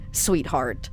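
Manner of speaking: normal talking voice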